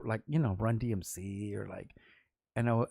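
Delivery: usual speaking voice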